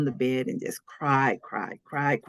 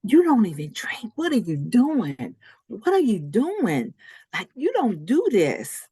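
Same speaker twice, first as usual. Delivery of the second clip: higher, incredulous son confronting his mother; reproachful pleading; 7 semitones higher than usual. Retold or imitated. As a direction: retell a son's dismayed scolding, pitched up. Son confronts drinking mother, retold.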